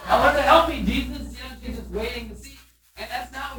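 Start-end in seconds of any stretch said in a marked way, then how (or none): 0.1-1.4 higher, louder demand with a laugh; half-laughing imitation of Martha's demand; mock Martha demand.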